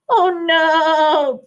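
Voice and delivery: high, loud little bleat; bleating sheep. A narrator's reading. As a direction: wail it like a frightened sheep. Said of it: "bleated the sheep".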